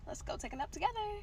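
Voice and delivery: high, teasing singsong reply; sarcastic comeback. Retold or imitated. To her boyfriend at the door, a sarcastic high tease. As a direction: tease him with a pushy sweetness. Showing up uninvited at his door.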